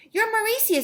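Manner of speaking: high, loud, lightly Scottish voice; a hint of a Scottish gentleman, exclaiming. A narrator's reading. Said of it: "Jason sounded like the gentleman from Scotland".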